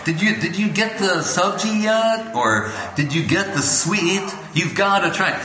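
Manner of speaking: a devotee urging others to taste the feast, excited; excited foodie. Retold or imitated. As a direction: rave about the food to a friend. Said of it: "You know, like".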